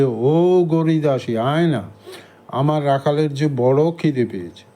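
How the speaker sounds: ordinary delivery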